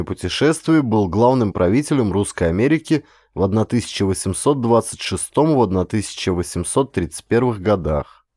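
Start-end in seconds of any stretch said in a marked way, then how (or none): none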